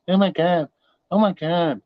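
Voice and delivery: put-on "oh my God" whine; mock outrage. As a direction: imitate an overreacting complainer. Mimicking people who overreact to crackling.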